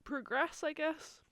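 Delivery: hiccups between words; talking through hiccups and burps; hiccuping. Real, not acted. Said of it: "I'm also hiccuping and burping".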